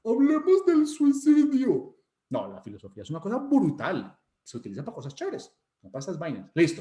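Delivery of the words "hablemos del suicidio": high, loud, slow mock proclamation; hammed-up grand debate opener; mock philosophy debater. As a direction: stretch it out in a raised parody voice. Mocks solemn philosophy debaters.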